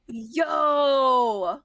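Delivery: loud, drawn-out exclamation of surprise; astonished. Real, not acted. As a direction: let one long stunned cry ring out. Astonished by the daughter fact.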